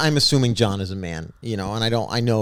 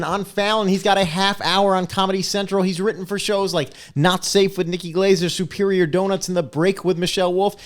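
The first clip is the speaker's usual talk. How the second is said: higher, upbeat guest introduction; host announcer; 6 semitones higher than usual. Acted. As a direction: hype up the guest like a show host.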